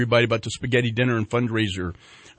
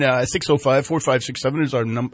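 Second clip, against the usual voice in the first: usual speaking voice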